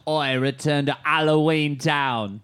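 slow, loud fake British; drawn-out cartoon British; mock British accent. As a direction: proclaim it in bad British. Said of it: "British accent I've ever heard".

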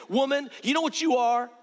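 a hammed-up scornful accusation; mock condemning. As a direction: throw it at her like a judge. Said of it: "He didn't say".

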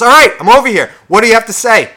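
loud, high, fed-up shout; exasperated reply. Acted. Person who finally came over answering back, exasperated shout. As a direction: shout back, giving in impatiently. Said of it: "and the person goes".

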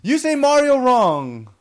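indignant high-pitched outburst; loud accusation. Real, not acted. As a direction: slowly holler it as an accusation. Heated row over pronunciation.

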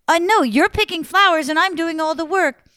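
high, loud complaint; exasperated. Acted. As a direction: protest in frustration. Prince exasperated.

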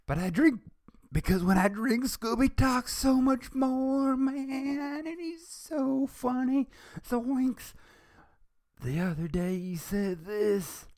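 very high, loud, slow stoner drawl; Shaggy voice. Acted. Shaggy explaining to the doctor why he drinks, high and loud. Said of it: "trying to do it like Shaggy".

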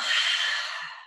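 long, unvoiced sigh out; audible exhale. Shown as a demonstration. Coach showing the class how to exhale, big sighed-out breath. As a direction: let out a big releasing breath. Demonstrates exhaling to release tension.

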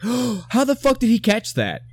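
high, stunned disbelief; can't believe what he just saw; retelling his stunned reaction in the theater; shocked disbelief. Retold or imitated. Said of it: "but I was like".

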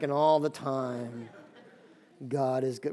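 low, slow, mopey mumble; Eeyore response. Retold or imitated. Preacher mimicking the congregation's dull reply like Eeyore. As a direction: answer with no life at all, like Eeyore. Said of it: "Really, Eeyore".

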